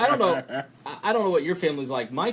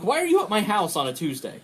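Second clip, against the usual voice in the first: high, loud, exasperated question; indignant complaint; 7 semitones higher, 5 dB louder than usual. Retold or imitated. Host confronts visitor on his doorstep, mock indignant. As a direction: protest in mock outrage at the intrusion.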